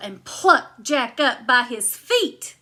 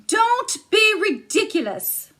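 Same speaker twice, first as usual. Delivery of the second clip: high, louder huffy giantess; 10 semitones higher, 4 dB louder than usual. Acted. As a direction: brush it off, pitched up. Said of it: "she said".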